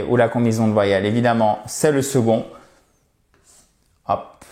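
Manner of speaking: ordinary talk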